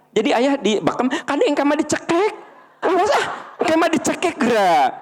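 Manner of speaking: high squeaky frightened voice, laughing; mock scared mother. (suara ibu ketakutan melengking sambil tertawa)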